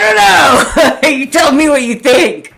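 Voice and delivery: high squeal of laughter over "don't know"; laughing. Real, not acted. Laughing at her own ex-boss's fancy rig.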